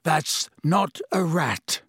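slow, hoarse croak. A narrator's reading. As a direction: croak it out slowly.

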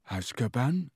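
confused questioning rise; puzzled. Acted. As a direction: repeat it, baffled.

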